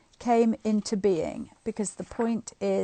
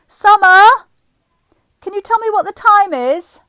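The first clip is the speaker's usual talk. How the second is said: loud, high call to someone in another room; 10 semitones higher, 14 dB louder than usual. Real, not acted. Mother calls out loudly to her daughter Summer for the time. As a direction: shout up to her across the house.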